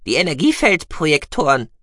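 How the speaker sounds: high, loud creature voice; squeaky mouse-beaver voice. Acted. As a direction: pipe it out eagerly. Gucki voiced.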